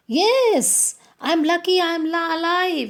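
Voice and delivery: high-pitched talking rooster; high, excited-sounding bird voice; rooster character. Acted. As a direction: speak up high as the cock.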